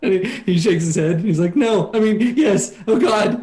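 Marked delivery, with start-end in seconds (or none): 1.6-3.4 high, panicky correction; flustered and frightened. Acted. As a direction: fumble the answer in a scared high voice. Terrified soldier answers captor.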